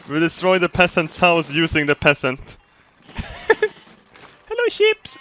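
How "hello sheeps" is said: very high, sing-song hello; squeaky mock-friendly hello; silly high greeting. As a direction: greet the sheep like a cartoon villain. Greeting sheep in character.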